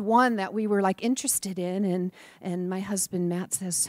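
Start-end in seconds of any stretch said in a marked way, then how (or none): none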